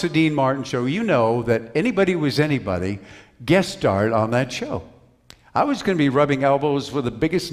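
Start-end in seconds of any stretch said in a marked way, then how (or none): none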